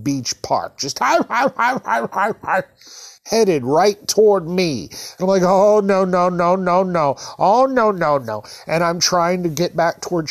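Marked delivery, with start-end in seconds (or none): 1.0-2.6 high-pitched imitation cackle; Laughing Sal cackle. Woman on inner tube bearing down on him, Laughing Sal laugh. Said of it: "she's laughing like Laughing Sal". 5.4-8.4 higher, rapid-fire dread; mock-panicked string of protests; retold panic. Kid seeing a woman headed right at him, panicked.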